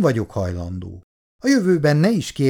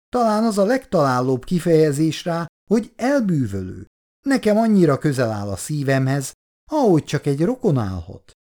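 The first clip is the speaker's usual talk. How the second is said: higher, sugary voice of a chatty aunt; gushing older lady; 5 semitones higher than usual. Acted. Olgi néni flattering Bondi about his wife, gushing. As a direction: gush over his wife with sweet flattery.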